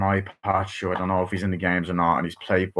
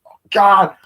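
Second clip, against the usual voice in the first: high, loud strained exclamation; hammed-up loud high yelp; mock alarm; 11 semitones higher, 8 dB louder than usual. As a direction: yelp it like you're hanging off an anchor.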